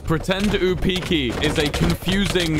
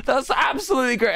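sarcastic shout; 11 semitones higher than usual. Acted. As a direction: yell it with fake delight. Mock cheer at chat message.

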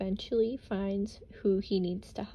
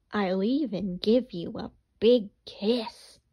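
louder, sly fox offering a kiss; sly menacing fox, louder; 6 dB louder than usual. Acted. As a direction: lean in like a predator.

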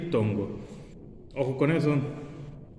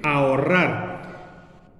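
ahorrar, very slowly with silent h; slow demo word; much slower than usual. Shown as a demonstration. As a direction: drag out ahorrar, a and o apart. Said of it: "ojo con esta que sigue". (ahorrar pronunciado muy despacio)

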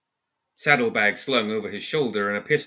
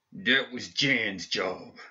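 slow, slurred, hurting voice; words dragged through pain; 4 dB quieter, much slower than usual. Acted. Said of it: "His voice was pained and slurred".

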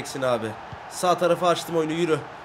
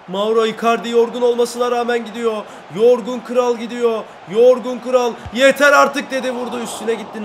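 hyped commentary; 6 semitones higher, 8 dB louder than usual. Real, not acted. Gamer commentating his striker's run, excited and loud. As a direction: sell the tired king's run.

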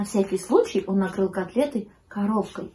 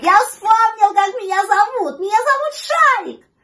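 high, loud jubilant pup; thrilled high-pitched yelling; puppy shouting; 12 semitones higher, 9 dB louder than usual. A narrator's reading. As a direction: shout the happy discovery in a piping voice. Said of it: "закричал щенок".

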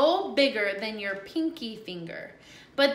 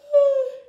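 high, loud groan of a stretch; 9 dB louder than usual. Real, not acted. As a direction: let out a big stretch groan. Big stretch sigh.

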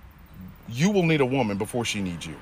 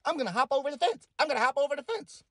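mocking singsong; 12 semitones higher, faster than usual. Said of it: "But don't try to".

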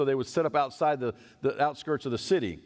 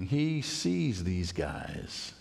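ordinary talk, nothing marked

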